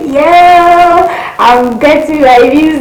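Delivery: high, loud, excited cheer. Retold or imitated. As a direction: relive the thrill of getting the visa. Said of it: "I was like".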